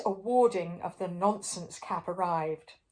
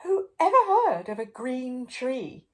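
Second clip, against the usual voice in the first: amazed question; 4 semitones higher, 5 dB louder than usual. A narrator's reading. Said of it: "exclaimed the king".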